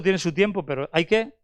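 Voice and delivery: ordinary delivery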